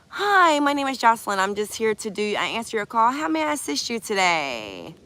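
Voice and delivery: sweet fake call-center politeness; customer service voice. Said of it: "put on your best customer service voice".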